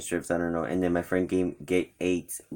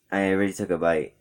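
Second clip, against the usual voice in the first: usual speaking voice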